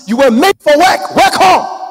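loud, high rallying cry; shouted exhortation. Real, not acted. Preacher rousing congregation to keep working, shouted exhortation. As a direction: punch it out and climb on the last words.

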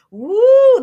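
a sudden high, loud woo; startled woo. Real, not acted. Host catches herself mid-sentence with a loud high woo. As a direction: yelp it out as the word slips away.

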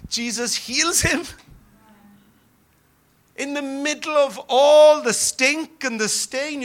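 loud, high fervent preaching; preaching at full force. Real, not acted. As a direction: build to a passionate peak.

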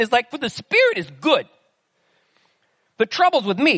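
Paul as a New York wiseguy, lightly done; New York gangster accent. Pretend. Preacher voicing Paul's confession in a light New York gangster accent. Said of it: "like, a New York City gangster accent".

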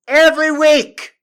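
high, loud outburst on every; exasperated yell. Acted. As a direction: shout it out fed up. Comic rant, louder repeat.

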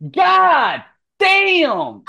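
high, loud, drawn-out yell; huge whooping outburst; astonished shout. Real, not acted. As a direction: holler each word in amazement. Game master reacts to die roll.

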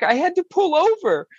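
ordinary talk, nothing marked

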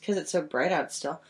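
ordinary talk

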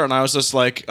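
normal talking voice

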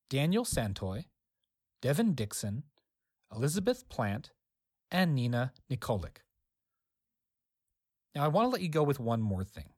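ordinary delivery

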